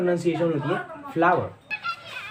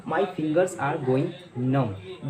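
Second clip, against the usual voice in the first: very slow, pausing before numb; slow, spaced-out dictation; teacher's model sentence; much slower than usual. Shown as a demonstration. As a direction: model the English slowly for learners. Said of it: "इसको English में कहते हैं".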